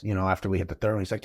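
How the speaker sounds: usual speaking voice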